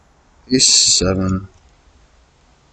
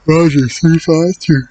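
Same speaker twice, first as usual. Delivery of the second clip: high, slow put-on radio voice; reading out the bet like a pilot confirming a call; mock radio call; 8 semitones higher, 4 dB louder, slower than usual. To himself at the poker table, a mock radio acknowledgement.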